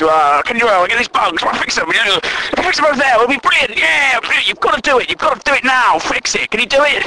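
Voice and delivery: high, insistent nagging; mock a colleague pushing for it right now; pushy know-it-all. Bossy colleague demanding that bugs get fixed, pushy. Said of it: "he seems to talk down to everyone".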